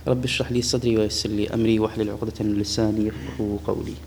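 low, level monotone supplication; murmured-level, unvarying Arabic prayer; opening prayer recitation. Acted. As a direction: recite the prayer softly on one low note.

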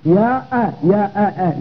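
"يا أ" with an abrupt glottal stop on "أ"; isolated hamza. Shown as a demonstration. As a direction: break after "يا" and pop out a short "أ". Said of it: "لاحظ أ".

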